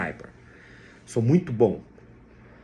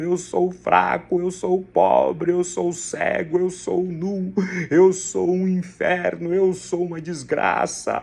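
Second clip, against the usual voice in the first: slow, higher, whining lament to God; complaining prayer; 3 semitones higher than usual. Pretend. Preacher mimicking a believer praying a complaint, slow whining. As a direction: moan it out as a self-pitying believer. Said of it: "Oração de reclamação".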